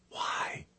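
barely audible whispered question. A narrator's reading. Kate asking Chris why, in a whisper. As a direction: whisper it, all breath. Whispered exchange while hiding.

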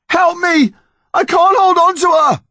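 high-pitched frantic yell; desperate shouting. A narrator's reading. Connor to the unmoving yew tree, desperate yell. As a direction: cry out for help in panic.

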